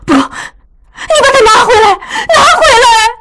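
very high, slow sobbing cry; crying shout. Acted. Daughter cries for maid to return sachet. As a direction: cry out to take it back. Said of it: "叫道".

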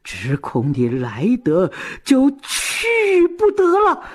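vengeful theatrical threat; fierce, vicious. A narrator's reading. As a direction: start contained, then hurl out the threat. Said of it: "狠狠地说".

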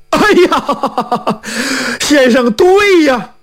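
high-pitched cry of sudden realisation. Acted. Blacksmith realises where the silver is, crying out. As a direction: exclaim it, pitch leaping up. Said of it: "这铁匠大叫一声".

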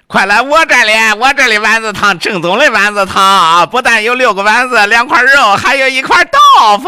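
loud, high hawker's shout; vendor shouting. Acted. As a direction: bellow the offer at customers. Last vendor shouting his offer.

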